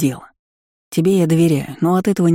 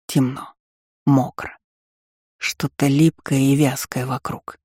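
hushed bleak narration. A narrator's reading. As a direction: read it like a numb cold dream. Dreamlike cold despair narration.

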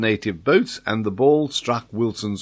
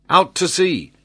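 high, urgent cry; exclaiming; 7 semitones higher than usual. A narrator's reading. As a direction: call it out as a desperate order, voice lifted. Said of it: "he exclaimed".

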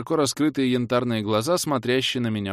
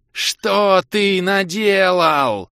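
slow, much higher, much louder roar; wild roar; 9 semitones higher, 8 dB louder, much slower than usual. A narrator's reading. Giant cobra roaring again at the hero. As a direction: bellow it like an enraged serpent. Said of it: "От дикого рёва".

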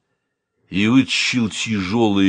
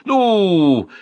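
insistent; 11 semitones higher, 5 dB louder than usual. A narrator's reading. Uncle forcing the spoon.

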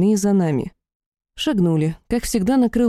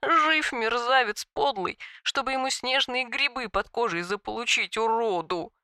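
tearful cursing; 3 semitones higher, 8 dB quieter than usual. A narrator's reading. Weeping dragon curses traitor.

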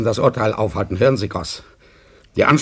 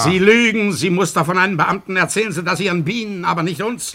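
angry accusation; 6 semitones higher than usual. Acted. Masur accusing Glienicke of lying, angrily. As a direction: call the man a liar to his face.

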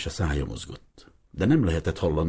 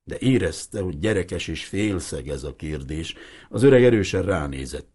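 ordinary delivery, nothing marked

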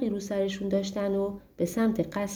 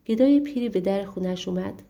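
ordinary delivery

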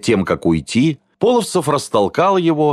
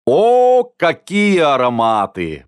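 delighted praise; 6 semitones higher, slower than usual. A narrator's reading. He praises the cooking smells from the kitchen doorway, delighted. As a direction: savour it, stretching the words admiringly. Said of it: "похвалил он".

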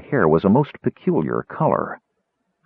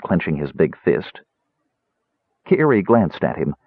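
ordinary delivery

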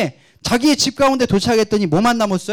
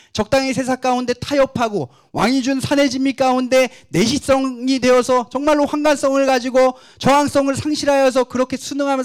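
high, sustained sermon delivery; fervent preaching; 5 semitones higher than usual. Real, not acted. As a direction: press the congregation with a raised, insistent voice. Preaches in sustained raised pitch. (높고 간절하게 밀어붙이는 설교조)

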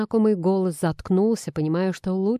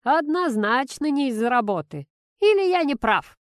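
higher-pitched, knowing purr; smirking purr; 6 semitones higher than usual. A narrator's reading. Boss teases the fiancé about her leaving, smirking purr. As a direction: needle him with a purring question. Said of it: "усмехнувшись, проурчал".

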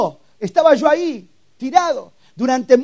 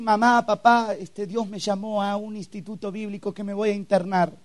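much softer, tentative voice; hesitant soft confession; 8 dB quieter than usual. Retold or imitated. Teen telling parents about Bible institute, soft and hesitant. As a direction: break the news timidly, barely projecting.

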